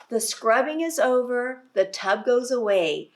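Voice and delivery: lively read-aloud voice; reading to children. Acted. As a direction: read it out big for a class of little ones. Reading picture book to children.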